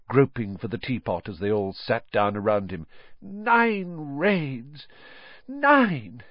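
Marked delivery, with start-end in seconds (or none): 3.2-6.2 high, drawn-out weary complaint; weary father. Acted. Father to his family after night raids, weary exclamation. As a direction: stretch it out, worn out by the night.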